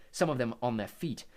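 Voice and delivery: ordinary talk, nothing marked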